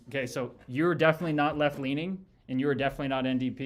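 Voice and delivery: usual speaking voice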